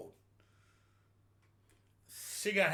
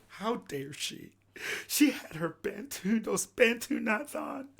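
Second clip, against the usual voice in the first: mock outrage; 5 semitones higher, slower than usual. Mock outrage at singer, slowly drawn out.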